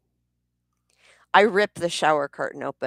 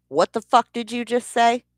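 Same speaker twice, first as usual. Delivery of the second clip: angry confrontation; 7 semitones higher, 3 dB louder than usual. Retold or imitated. Confronting husband after hearing mutter.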